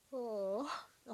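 real yawn mid-word. Real, not acted. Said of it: "あくび出ちゃったよ。".

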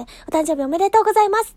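"おめでとうございます" said with the voice she apologises for; rough voice. Real, not acted. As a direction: congratulate through a strained voice. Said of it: "ちょっとこんな声で".

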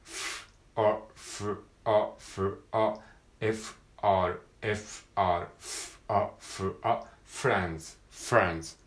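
F and R split, then joined in friends; pronunciation drill; FR cluster drill. Shown as a demonstration. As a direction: build from F plus R into friends. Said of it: "子音が二つ連続".

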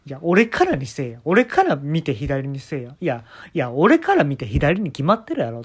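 slow, loud exasperated retort; annoyed retort. Retold or imitated. The comedian snapping back at the fortune teller, slow and loud. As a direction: push back hard, drawn out.

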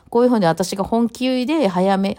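usual speaking voice

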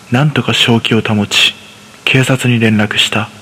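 ordinary talk, nothing marked

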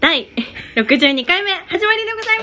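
whiskey voice. Pretend. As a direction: imitate a rakugo storyteller. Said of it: "伯山さん風に言ってみました".

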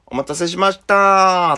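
high, slow sweet welcome; a hint of a coy hostess greeting; polite shop greeting. A put-on sweet welcome to a nervous first-timer. Staff greeting voiced in story.

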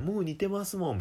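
high mock enthusiasm; enthusiastic clerk. Imagined clerk praise.

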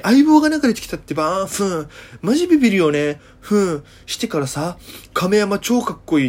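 put-on Okinawan girl chatter. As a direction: gush like a smitten girl. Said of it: "ふーんってかましてくるんですよ".

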